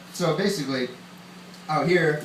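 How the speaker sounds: ordinary talk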